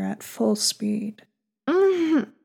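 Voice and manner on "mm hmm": high moan. Acted. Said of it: "She grunted".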